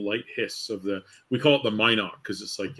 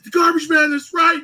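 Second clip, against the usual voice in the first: put-on high, loud excited yell of a drunk fan; drunk fan shouting; 9 dB louder than usual. Drunk cruise fan recognizing the wrestler, shouting high and loud. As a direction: bellow it like a drunk superfan. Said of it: "He's like".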